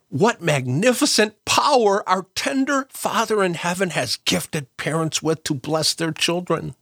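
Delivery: ordinary talk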